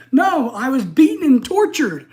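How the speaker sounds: fired-up retort. Retold or imitated. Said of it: "I'm getting excited".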